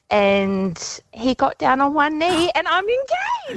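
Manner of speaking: delighted reveal. Real, not acted. Caller telling hosts of her engagement, delighted and slow. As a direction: let the joy lift and stretch it out.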